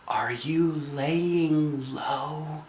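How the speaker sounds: slow, soft whisper; hushed child whisper. Acted. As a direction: breathe it so nobody hears. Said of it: "I whispered".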